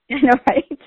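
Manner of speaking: laughing. Real, not acted. Amused by her husband's line, a loud laugh. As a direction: let the laugh spill into it.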